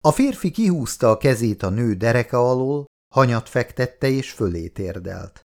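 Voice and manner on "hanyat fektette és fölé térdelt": ordinary delivery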